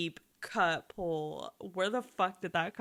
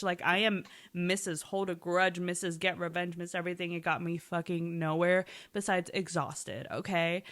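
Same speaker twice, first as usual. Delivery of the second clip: normal talking voice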